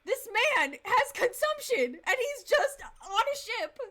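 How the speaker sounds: high, loud, slow-stretched disbelief; aghast rant. Real, not acted. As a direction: wail each word in incredulous horror. Aghast that sick man is aboard.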